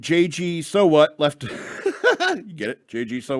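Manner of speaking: chuckling through the pun, slow; laughing at own joke. Real, not acted. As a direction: laugh while pointing out the joke.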